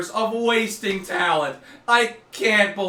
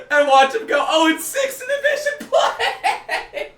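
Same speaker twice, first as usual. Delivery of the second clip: laughing. Real, not acted. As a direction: shriek it through laughing. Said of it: "the reason why I'm laughing so hard".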